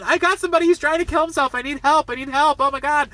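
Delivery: high-pitched, hammed-up plea for help; feigned frantic panic. Woman faking a desperate emergency call, panicked. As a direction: plead hysterically, voice held way up. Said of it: "she's, like, crying throughout the scene".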